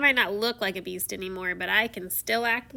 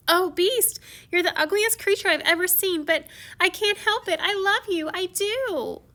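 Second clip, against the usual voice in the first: higher voiced tender plea; Beauty's tearful confession; 8 semitones higher than usual. Beauty weeping over the Beast, lightly voiced higher. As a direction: plead with him through tears.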